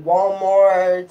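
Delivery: normal talking voice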